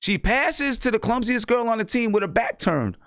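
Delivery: high, exasperated storytelling; incredulous. Real, not acted. Incredulous retelling of bad pass.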